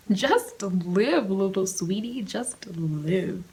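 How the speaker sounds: a hint of a cooing, patronising voice; lightly voiced sweet coaxing; mock sweet advice. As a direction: coo it like talking to a child.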